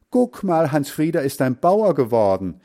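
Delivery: put-on loud, high, gleeful girls' taunt; teasing shout. Sisters dancing around their brother, loudly teasing. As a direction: crow it out with glee. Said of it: "Sie riefen".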